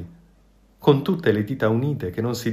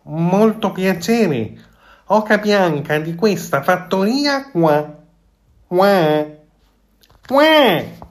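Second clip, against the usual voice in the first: high, slow, louder goose greeting ending in shrill quacks; goose with more conviction; 9 semitones higher, 6 dB louder, slower than usual. A narrator's reading. The goose greets from inside the fox's belly, high and proud. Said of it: "con maggiore convinzione".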